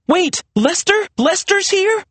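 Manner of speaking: very high, disbelieving questions; incredulous demand. A narrator's reading. Serena demanding answers from behind Maria, incredulous. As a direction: demand to know, astonished. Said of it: "Serena demanded from behind her".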